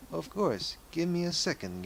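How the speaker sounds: lightly voiced old lady, pitched high; polite old lady. Widow to her young visitor, lightly acted high old-lady voice.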